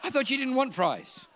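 high, loud accusing shout rising; wife's indignant shout. Retold or imitated. Wife scolding him over stolen fries, shouting. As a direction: yell it like a wife catching him.

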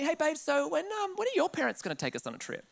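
high, soft wheedle imitating his wife; imitated sweet, offhand nudge; casual hinting. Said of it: "bring it up in conversation, just slightly".

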